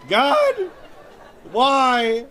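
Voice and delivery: very high, loud mock cry to heaven; anguished wail. On his knees crying out to God, hammed-up wail. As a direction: rail at God, overdone. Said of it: "And I was like".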